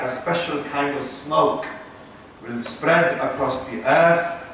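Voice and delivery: ordinary delivery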